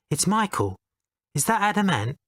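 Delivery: a gentle caller patiently saying who he is; soft high voice. Retold or imitated. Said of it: "said the soft voice".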